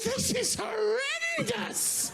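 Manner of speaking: very high, drawn-out mock scream; strained, slow falsetto cry; horrified thought. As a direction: squeal it in exaggerated horror. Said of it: "and I thought".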